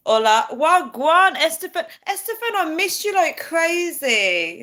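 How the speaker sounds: high, loud, bubbly hellos to viewers; excited greetings. Real, not acted. Streamer greeting fans in many languages, excited and high. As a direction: squeal the greetings out.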